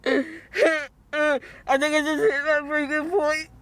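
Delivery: slow, tearful breaking point; crying, words dragged out; real upset. Real, not acted. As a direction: talk through sobs as it overwhelms you. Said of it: "I'm so upset right now".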